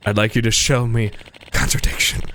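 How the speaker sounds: normal talking voice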